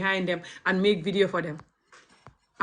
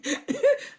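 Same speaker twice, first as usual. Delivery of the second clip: much higher, pained laugh that rises; high bitter laugh. Real, not acted. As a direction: laugh while hurting inside. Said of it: "I'm laughing, but I'm crying".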